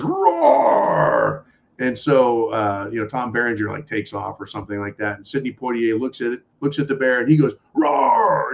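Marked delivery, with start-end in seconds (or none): 0.0-1.5 loud, high bear roar; long, full-voiced bear's roar. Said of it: "This bear stands up and goes". 7.7-8.5 loud, high roar back at a bear; man imitating a roar at full volume; yelled roar. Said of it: "yells at the bear".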